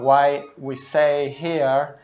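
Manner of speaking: ordinary talk, nothing marked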